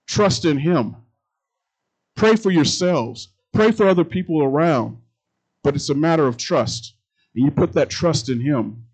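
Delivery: loud, ringing sermon delivery; booming pulpit voice; full-force preaching. Acted. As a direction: preach it out to the congregation.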